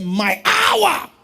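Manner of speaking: high, very loud preaching cry; shouted scripture quote. Acted. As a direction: cry it out to the whole congregation.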